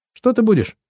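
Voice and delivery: high, prodding repeated question. A narrator's reading. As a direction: ask it over, pitched right up. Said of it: "повторила Энни вопрос".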